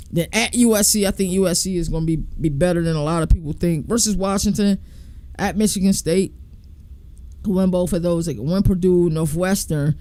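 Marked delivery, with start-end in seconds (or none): none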